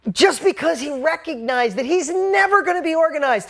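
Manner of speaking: loud, high-pitched passionate rant; real worked-up outburst, loud and high; fired up. Real, not acted. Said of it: "I'm just in a space".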